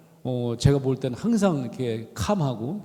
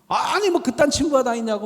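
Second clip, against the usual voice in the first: high, louder mock indignation; a hammed-up angry protest, pitched high; retold indignant outburst; 9 semitones higher, 4 dB louder than usual. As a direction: huff it out like you're fuming for a friend. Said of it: "막 저도 막 승질을 낸 거지".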